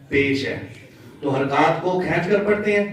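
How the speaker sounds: ordinary delivery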